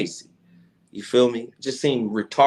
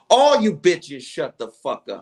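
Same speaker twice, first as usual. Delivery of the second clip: retold yell; 5 semitones higher, 4 dB louder than usual. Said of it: "them yelling and screaming".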